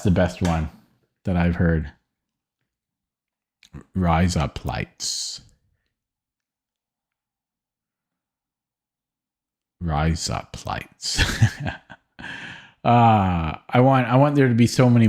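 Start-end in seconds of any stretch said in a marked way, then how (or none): 3.9-11.2 thick Australian accent. Shown as a demonstration. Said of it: "very thick Australian accent".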